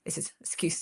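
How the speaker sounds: normal talking voice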